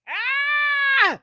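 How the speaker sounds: a high squealing laugh then a cry of ah; shrieking laugh. Real, not acted. Host cracking up at her own joke, shrieking laugh.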